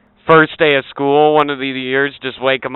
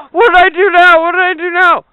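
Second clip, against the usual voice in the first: put-on much higher, louder protesting child; whiny kid; 7 dB louder than usual. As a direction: kid in a panic over nothing.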